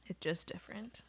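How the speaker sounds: hushed whisper. Real, not acted. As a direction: breathe it out quietly. Whispered aside.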